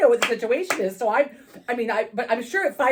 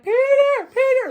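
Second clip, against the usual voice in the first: much higher, louder call of Peter; yell; 5 dB louder than usual. Retold or imitated. Desperate to pee, yelling for her friend. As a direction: holler it through the door hole. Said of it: "and I'm yelling".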